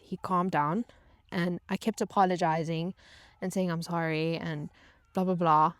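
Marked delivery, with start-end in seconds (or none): none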